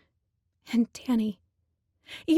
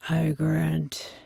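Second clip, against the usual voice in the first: low, slow, breathy beast voice; low slow Minotaur; 4 semitones lower, much slower than usual. A narrator's reading. Minotaur telling the girl what he does for her, low and slow. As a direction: drag the words out deep and slow. Minotaur explains his duty.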